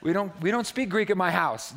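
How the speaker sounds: exaggerated whiny push-back; mock objecting congregation. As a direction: complain loudly like a reluctant student. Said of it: "it's like".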